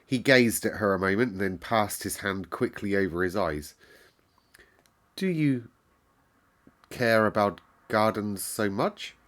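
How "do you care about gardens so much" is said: slowly. A narrator's reading. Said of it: "he said slowly".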